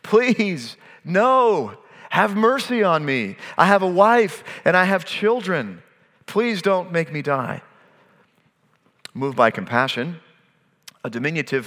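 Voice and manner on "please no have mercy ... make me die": voiced pleading, a little higher; pleading cry. Prisoner begging the Nazis for mercy, mild pleading cry. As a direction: plead for his life as the prisoner. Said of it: "cried out in a loud voice".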